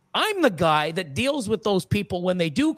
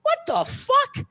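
slow, very high squeal of disbelief; mock shocked buyer; slower than usual. Buyer staring at a tiny animal, mock shock. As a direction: drag it out in a high, hammed-up voice.

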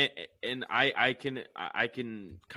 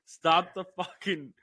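loud, high, drawn-out plea; exasperated sports-fan holler; hammed-up movie shout; 6 semitones higher, 9 dB louder, slower than usual. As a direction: belt it out slowly, every word stretched. Acting out the movie quote.

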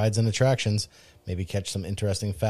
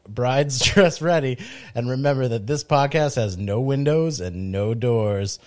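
normal talking voice

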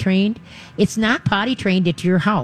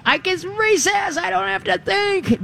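much higher, slow, giddy dog voice; overexcited dog; 14 semitones higher, slower than usual. Retold or imitated. The dog's inner voice after hearing good boy, giddy and high. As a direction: voice the dog gone wild with excitement. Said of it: "into overdrive, into Nutsville".

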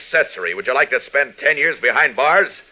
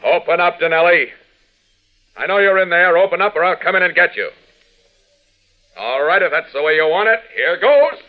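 loud, firm demand through a door; commanding call; 4 semitones higher, 3 dB louder than usual. Acted. Superman calls through the killer's door, loud and commanding. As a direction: bang on the door and order him out.

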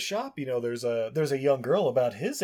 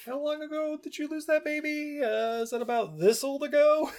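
much higher put-on voice; hammed-up questioning in a high voice; lightly mocking villager asking about the baby; 14 semitones higher than usual.

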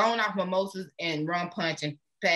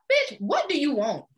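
normal talking voice

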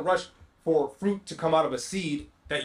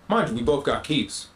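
ordinary talk, nothing marked

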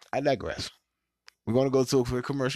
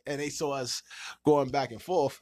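ordinary talk

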